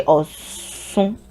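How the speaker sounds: Osun with a long hissed s, "aw-sssoon"; drawn-out S sound. Shown as a demonstration. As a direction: split Osun slowly before a long s. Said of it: "they don't say Oshun".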